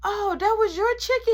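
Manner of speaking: high, put-on innocent old lady; nana voice. Nana innocently asks the uncle, high voiced. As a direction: play it as a surprised grandma. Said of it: "She say".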